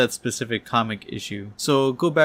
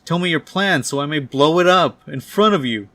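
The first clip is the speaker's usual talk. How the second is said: loud, raised-pitch demand; furious yell; 5 semitones higher, 6 dB louder than usual. Acted. Doc Ock up close to Norman, furious yell. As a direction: yell it right into his face. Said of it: "close to his face and yells".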